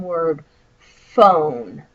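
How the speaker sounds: phone said the normal way, as fone; sounding out phone. Shown as a demonstration. Teacher pointing at the written word. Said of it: "when you taught me to sound out words".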